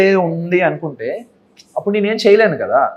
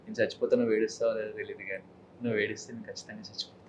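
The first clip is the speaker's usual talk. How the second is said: very soft, low, intimate murmur; romantic; 6 semitones lower, 17 dB quieter than usual. Shown as a demonstration. Actor performs a love dialogue for the host, softly romantic. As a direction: confess love, low and hushed. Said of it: "romantic గా".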